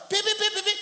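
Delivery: very high, loud beeping; rapid shrieked honks; imitated motorbike horn. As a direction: honk like a passing motorbike. Imitates passing motorbike horns.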